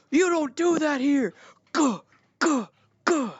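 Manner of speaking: high-pitched guard impression; mock angry guards. Acted guards attacking in story.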